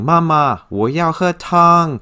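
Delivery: put-on high, eager child calling to mom; excited child. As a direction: pipe up excitedly like a hungry child. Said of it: "You can hear the excitement in the child's voice".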